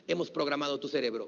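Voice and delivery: usual speaking voice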